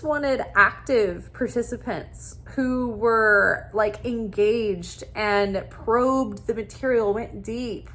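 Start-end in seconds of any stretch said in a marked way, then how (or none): none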